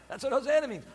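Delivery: normal talking voice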